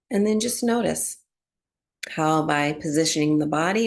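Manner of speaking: calm, unhurried meditation voice with long pauses; guided relaxation. Real, not acted. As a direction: ease them gently into breath awareness, phrase by phrase. Guides breath awareness and body relaxation.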